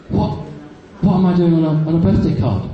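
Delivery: shocked. Retold or imitated. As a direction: lightly act out baffled disbelief. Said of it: "I was so shocked".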